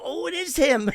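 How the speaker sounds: high, loud mock amazement; excited recognition. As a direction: imitate a starstruck agent spotting someone. Other agent recognizes the author, retold.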